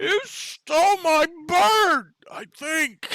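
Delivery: very high, slow, loud slurred heckle; drunk guy. Acted. A drunk in the crowd heckles the party, slurring. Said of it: "one drunk guy back there who's like".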